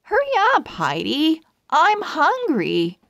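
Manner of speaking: high, impatient call to a friend; impatient kid calling, pitched high. A narrator's reading. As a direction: call across the room, eager. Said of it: "Lucy called out".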